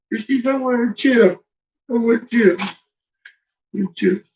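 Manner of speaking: high voice speaking for the dog; high doggy voice; voicing the dog. Voicing the dog's wants.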